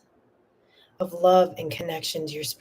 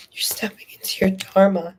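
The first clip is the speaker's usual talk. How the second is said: whisper; 3 dB louder than usual. Real, not acted. Reader whispering the message to Mercy. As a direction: breathe it like a promise.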